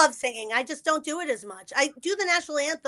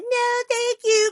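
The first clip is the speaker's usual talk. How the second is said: scared; 11 semitones higher, 6 dB louder, much slower than usual. Retold or imitated. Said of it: "'cause I'm, like, scared".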